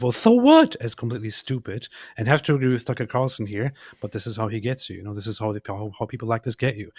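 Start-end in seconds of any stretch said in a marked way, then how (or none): 0.0-0.8 loud, high mock objection; Piers Morgan. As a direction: blurt it out as a dismissive parody. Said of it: "Piers Morgan's objection of".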